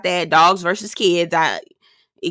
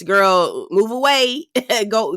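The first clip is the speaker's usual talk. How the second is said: usual speaking voice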